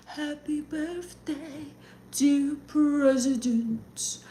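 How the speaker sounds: slow, soft, breathy birthday serenade; Marilyn Monroe impression. Reminded of Marilyn Monroe, she coos slow and soft. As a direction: coo it slowly like Marilyn singing to the president.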